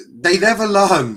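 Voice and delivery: loud, high cry of despair; they never learn, yelled up high; fed-up football fan losing patience; exasperated outburst. Real, not acted. Exasperated at United's slow play.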